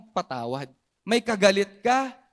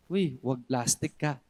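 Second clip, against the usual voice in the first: low, slow mock jab at the neighbor; mock teasing jab; 9 semitones lower, 4 dB quieter, slower than usual. Congregant teasing the person beside them, low slow jab. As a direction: poke at them in a lightly put-on low voice.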